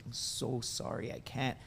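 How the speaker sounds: soft apology. Retold or imitated. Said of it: "she's like".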